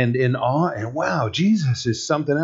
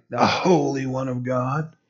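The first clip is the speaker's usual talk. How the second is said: understated contemptuous accusation; spat-out accusation; 4 dB quieter than usual. Acted. Preacher voicing the demoniac in the synagogue, accusing. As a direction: sneer it as an accusation, no reverence. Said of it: "he's saying that as an accusation".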